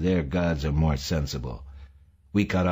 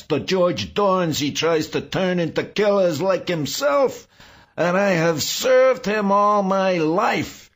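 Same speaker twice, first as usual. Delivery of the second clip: very high, loud, hysterical tirade; raging shriek; 12 semitones higher, 5 dB louder than usual. A narrator's reading. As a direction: shriek curses at your tormentor. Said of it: "Saul shrieked".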